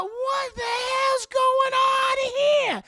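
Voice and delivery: very high, loud, stretched-out wail; hammed-up screeching panic, slow and piercing; panicked shriek. Aunt Bam as the tap turns itself on, panicked shriek.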